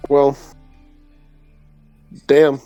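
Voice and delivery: normal talking voice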